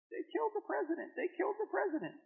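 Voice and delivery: put-on a young mother weeping as she tells it; mother's grief.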